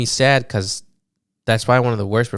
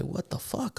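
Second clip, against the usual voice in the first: confused; 7 semitones higher, 14 dB quieter than usual. Retold or imitated. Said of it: "I was kinda confused".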